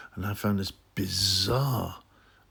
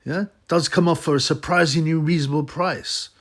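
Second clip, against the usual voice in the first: raised, louder mocking reviewer read; 4 semitones higher, 3 dB louder than usual. Vlogger mocking the reviewer's review, pitched up and louder. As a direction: read it out like a leering fan, pitched up. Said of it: "this pervert goes on to write".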